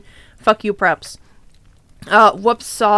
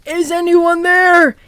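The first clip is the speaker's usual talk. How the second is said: slow, higher call out; mock yell; 5 semitones higher, 3 dB louder, slower than usual. Janitor calling out to the hidden students, a mock yell. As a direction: call slowly into the dark. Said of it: "yelled Mister Norris".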